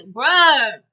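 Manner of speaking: loud, drawn-out protest; exasperated outburst. Acted. Viewer protesting his complaint to him, exasperated outburst. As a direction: let it burst out long and loud. Scolds character in retold exchange.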